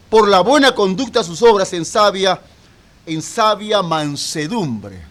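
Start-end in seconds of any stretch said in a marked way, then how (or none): none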